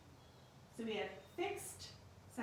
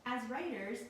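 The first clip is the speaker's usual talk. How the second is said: normal talking voice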